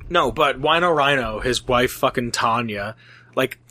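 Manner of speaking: usual speaking voice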